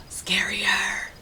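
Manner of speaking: gasp, then a breathless whisper; scared whisper. A narrator's reading. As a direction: hush it out as if spooked. Narrator reacts to scary story.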